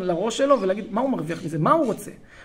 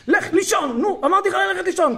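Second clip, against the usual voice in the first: high-pitched, impatient bedtime order; tense, angry parent; 11 semitones higher, 4 dB louder than usual. Retold or imitated. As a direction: act a tensed-up parent snapping at a child. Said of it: "ועם הידיים תעשה ככה חזק". (הורה לחוץ שפוקד בקוצר רוח)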